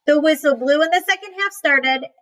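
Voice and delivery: ordinary delivery, nothing marked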